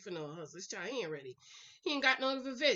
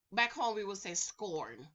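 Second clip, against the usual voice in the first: ordinary talk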